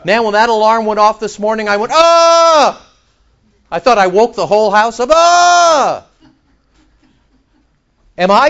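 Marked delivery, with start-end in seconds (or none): 1.9-2.9 startled yell. Retold or imitated. Alarm went off this morning. 5.1-6.0 startled yell. Retold or imitated. Re-enacting the alarm shock.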